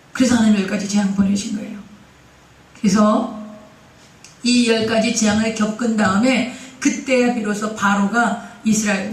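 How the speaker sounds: ordinary talk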